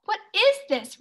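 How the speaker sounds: high, loud indignant complaint; whiny high grumble; complaining. Acted. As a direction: protest at the empty chest. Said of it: "Ricky complained".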